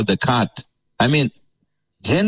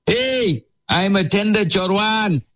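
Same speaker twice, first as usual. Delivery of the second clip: much higher, called out like through a loudspeaker; a show-off official bragging through a loudspeaker; mock shout; 9 semitones higher, slower than usual. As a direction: boast it to the whole street. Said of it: "and shout to the world".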